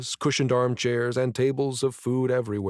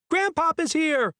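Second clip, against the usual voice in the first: put-on high, bright child's cheer; a little kid yelling the news with joy; excited child; 3 dB louder than usual. A narrator's reading. Child runs up announcing grandpa.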